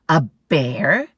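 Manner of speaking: loud, disbelieving; doubting mom. Acted. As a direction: scoff at the idea.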